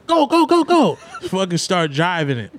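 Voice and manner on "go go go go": high, urgent repeated go; imitating panicked teens yelling go; frantic urging. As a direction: push the driver to floor it.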